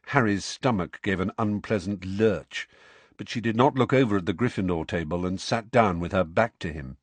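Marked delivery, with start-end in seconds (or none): none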